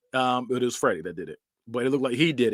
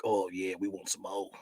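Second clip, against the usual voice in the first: low, soft creepy craving voice; hungry track marks; 7 semitones lower, 12 dB quieter than usual. Retold or imitated. Taryn's track marks begging for drugs, low soft creepy croon. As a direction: croon like a greedy little mouth. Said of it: "they're all like".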